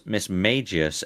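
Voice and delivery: MAGIUS as "MAY-jee-us", like mage; second pronunciation variant. Shown as a demonstration. Listing variants for co-host, Magius said like mage. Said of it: "'Cause there's, there's mage".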